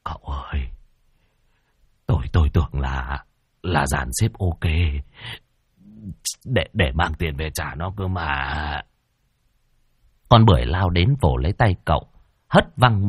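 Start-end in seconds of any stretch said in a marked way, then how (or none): 0.0-8.8 soft, stammering, breathless gasp; faint feeble whisper. Acted. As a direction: barely get the words out in shock. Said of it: "Môn Phọt thều thào".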